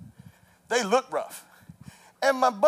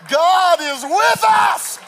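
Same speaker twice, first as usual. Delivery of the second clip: triumphant declaration; 9 semitones higher, 6 dB louder, much slower than usual. Acted. Preacher's punchline shouted.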